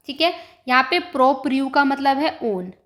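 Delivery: ordinary talk, nothing marked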